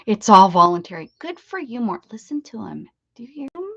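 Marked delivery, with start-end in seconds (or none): 1.8-3.7 breathy hushed aside; whisper. Real, not acted. Whispering to viewers to listen to the dog, soft whisper.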